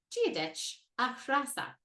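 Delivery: slow careful "Gia dich a Trasa"; Irish greeting demo. Shown as a demonstration. Teacher to learners, slow clear model. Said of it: "we say".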